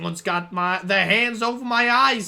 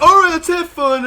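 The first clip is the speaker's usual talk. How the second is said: cheery quote; 10 semitones higher, 11 dB louder than usual. Acted. Voicing party cheer.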